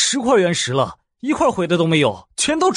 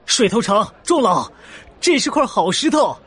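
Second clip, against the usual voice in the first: excited shout. Acted. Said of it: "就有人喊了起来".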